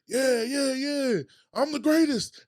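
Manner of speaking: high, loud, slow bragging boast; boastful Muhammad Ali. Retold or imitated. Bragging at the top, mocking a boxer's boast. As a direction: crow like the champ on top of the mountain. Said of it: "you talking like Muhammad Ali".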